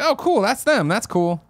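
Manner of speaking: high casual fan voice. Retold or imitated. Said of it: "We're very much just like".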